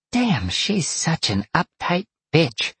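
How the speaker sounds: high, slow grumble; grumbling vixen. A narrator's reading. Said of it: "the vixen grumbled".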